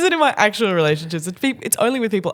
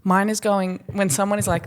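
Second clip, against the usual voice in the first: ordinary talk, nothing marked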